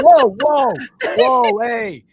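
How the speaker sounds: loud, high alarmed whoas; mock protest, calling someone off; objecting Italian Americans. Host imitating affronted Italian Americans watching the game, in loud mock alarm. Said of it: "Italian Americans who were like".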